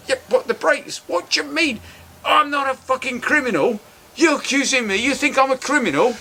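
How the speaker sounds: mock indignant outburst. Salesman protests he is no criminal, hammed-up outrage. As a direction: play the wounded salesman. Salesman takes offence, retold.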